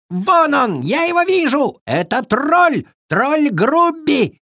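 high-pitched cries of discovery; excited calling out. A narrator's reading. Squirrel spots the troll from a pine, calling out excitedly. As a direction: call it out high to your friends.

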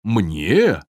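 understated, puzzled question; surprised Viking. A narrator's reading. Viking learns the cake was his, mildly surprised. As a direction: ask with mild surprise. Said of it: "удивился викинг".

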